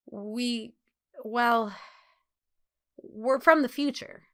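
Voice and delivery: halting, broken start with nervous repeats; stammering. A narrator's reading. Bernard nervously explaining to the girls, stammering. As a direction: stumble over the first words. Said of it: "he stammered".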